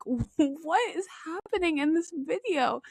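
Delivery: high, slow, incredulous question; stretched-out mock horror; shocked disbelief. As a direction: act out appalled bewilderment. Acting out a viewer's shock.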